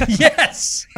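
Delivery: amused laughing agreement. Real, not acted. Laughing at the Beatles quote.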